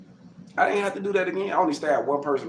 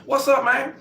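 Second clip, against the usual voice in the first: mock confrontation yell; 13 semitones higher, 4 dB louder than usual. Retold shower fight, confronting him.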